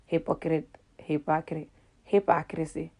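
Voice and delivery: low, quiet practice of "hypocrite" and "hypocrisy"; careful pronunciation attempt. Shown as a demonstration. Practising a word she cannot say, careful and subdued. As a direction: try "hypocrite" softly, word by word. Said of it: "I cannot say the word hypocrite".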